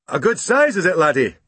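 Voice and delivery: put-on high, friendly policeman to a child; kindly constable teasing a boy; london policeman. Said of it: "he asked".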